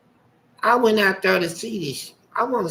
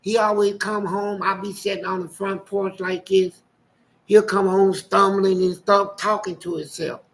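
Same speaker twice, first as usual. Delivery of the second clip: normal talking voice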